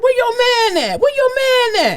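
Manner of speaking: hammed-up high voice for the worker; imitating the dump worker. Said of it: "He was like".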